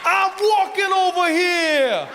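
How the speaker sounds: high, slow, stretched New Yorker yelling; New York accent shout. Retold or imitated. Comic mocking a New Yorker yelling at a taxi, in a high stretched shout. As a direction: ham up a brash New Yorker yelling at traffic. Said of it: "That was all I thought New York was".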